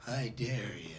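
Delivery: hushed dare that seals the deal; low sly dare. Acted. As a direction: drop suddenly low and soft to goad him. Sly dare clinches the coaxing.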